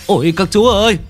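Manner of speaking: high, frantic begging shopkeeper; panicked pleading. Acted. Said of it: "tiếng kêu của ông chủ xót tiền".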